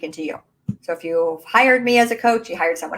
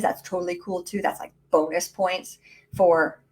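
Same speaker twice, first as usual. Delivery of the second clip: ordinary delivery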